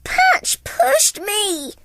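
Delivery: high, slow whiny pup; tattling puppy. Acted. Lucky blaming Patch, high slow whine. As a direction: tattle on your brother. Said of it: "Lucky said".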